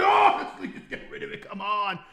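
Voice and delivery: high, thin pleading; hammed-up desperate whine; mock begging. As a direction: beg in an exaggerated squeak. Mock begging God, second take.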